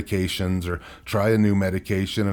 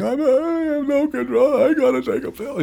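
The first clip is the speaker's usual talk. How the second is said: much higher, mock breaking voice; retold crying; 3 dB louder than usual. He comes home to his wife with the pills, in a much higher, mock-crying voice. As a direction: imitate yourself sobbing it out. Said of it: "go home crying to my wife".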